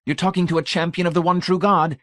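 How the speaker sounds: high, proud voice of a zealot; zealous champion. Acted. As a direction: declare your faith with lofty pride. Brady's zealous voice.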